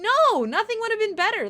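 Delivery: loud, high indignant no; indignant outburst. Real, not acted. Venting about the torn dollar tip, loud indignant outburst. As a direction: fire back at the idea.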